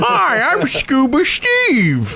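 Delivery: high, slow cartoon character voice; Scuba Steve. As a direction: greet everyone in a silly high put-on voice. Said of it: "See, I, I do, uh, voices".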